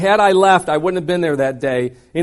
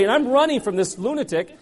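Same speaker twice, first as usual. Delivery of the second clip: ordinary delivery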